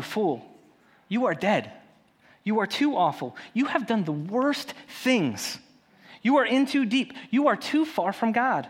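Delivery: voiced accusers piling on blame; accusing. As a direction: build the condemnation point by point, pitch climbing. Voicing accusers condemning the listener.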